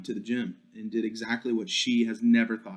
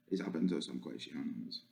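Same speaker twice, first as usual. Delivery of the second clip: very low, soft playful voice; low and hushed; 9 semitones lower, 12 dB quieter than usual. Pretend. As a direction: drop it way down, softly. Repeats the line in silly voices.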